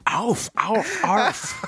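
high little dog barks; yappy chihuahua voice. Pretend. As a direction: yap like a tiny dog. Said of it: "Rocker Spaniel".

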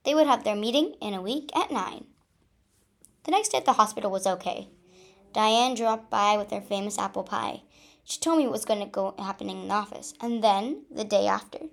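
usual speaking voice

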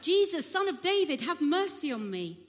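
loud, high call, easing off. A narrator's reading. Blind beggar to Jesus passing by, calling out. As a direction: call out a plea over a crowd. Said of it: "he called out".

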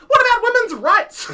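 shrill high outraged cry; mock preachy feminist. Mocking a character popping out of pot plants, shrieking. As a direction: squeal it like a ranting caricature. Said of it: "popping out of pot plants and being like".

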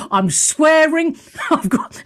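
ordinary talk

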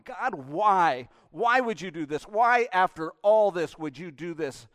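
loud, pained pleading why; voicing our bitter protest; anguished complaint to God. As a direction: cry out at God in hurt. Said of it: "we're looking and we're going".

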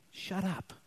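a soft, curt hush; mild wife shushing her husband; curt shut up. Pretend. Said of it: "would turn to me and say".

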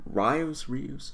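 Rios tried as "rye-ohs", soft and questioning; unsure pronunciation. Real, not acted. Host unsure of the executive's name, trying Rios softly as "rye-ohs". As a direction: try Rios softly with an "eye" vowel, unsure. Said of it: "Sorry if I got your name wrong".

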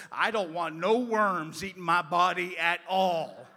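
high, very loud acted refusal; hammed-up childish outcry; loud mock protest. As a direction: belt it out like a panicked kid, played for laughs. Retold frightened child's protest, shouted.

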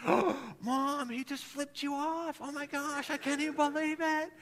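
high, shocked child voice imitating the kids. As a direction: kids gasping at a rude driver, high and shocked. Kids shocked at driver's gesture.

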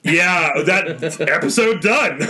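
laughing through the words; slow, laugh breaking into a high giggle. Real, not acted. Signing off amid laughter.

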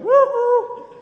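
very high, loud woo-hoo; imitated crowd whoop. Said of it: "They'll all start going".

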